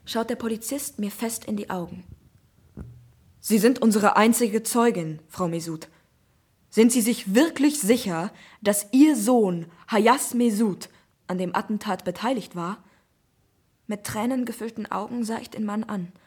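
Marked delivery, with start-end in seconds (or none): 3.4-12.8 stern inspector. Acted. Inspector questioning witness.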